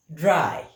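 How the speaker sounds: "Drei" said loud and clear, drai; model word for repeating. Shown as a demonstration. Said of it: "That is three".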